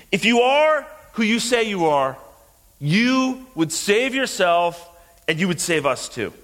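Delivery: high, railing demand; acted-out heckler's challenge; shouted challenge. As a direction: throw it at him as a taunt. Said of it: "this man is shouting at him".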